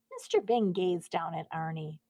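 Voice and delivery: usual speaking voice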